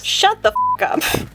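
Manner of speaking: very high, exaggerated shut-down; mock outrage. Vlogger to imagined objecting viewer, high mock outrage. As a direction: cut the objector off in a high squeal.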